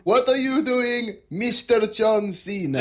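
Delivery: badly faked foreign accent, playing it for laughs; Borat. Co-host as the attorney questioning John Cena, mock Russian accent. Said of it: "He sounded like Borat".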